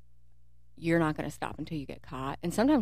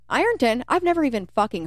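loud incredulous echo; 6 semitones higher, 6 dB louder than usual. Retold or imitated. Disbelief at place name.

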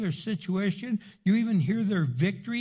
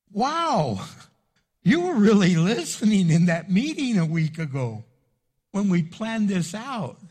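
louder, emphatic retold amazement; impressed amazement; 2 semitones higher, 6 dB louder than usual. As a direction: voice the family's impressed reaction, loudly. Retold family's impressed reaction.

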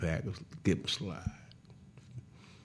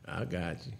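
usual speaking voice

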